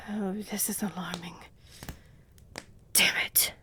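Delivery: whispered alarm; hushed dismay; worried whisper. Acted. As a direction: mutter it under breath. Vampire learns liaison missing.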